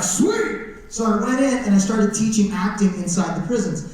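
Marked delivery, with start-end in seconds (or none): 0.0-0.6 a much higher, thrilled "Sweet"; delighted. Retold or imitated. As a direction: squeak it out with glee. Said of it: "So I was like".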